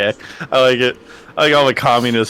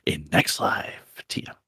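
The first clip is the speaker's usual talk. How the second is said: whispered trademark, much softer and lower; crisp icy whisper; 9 semitones lower, 10 dB quieter, slower than usual. Acted. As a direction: breathe the brand name out coldly. Trademark tag after pitch.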